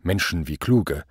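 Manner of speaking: ordinary delivery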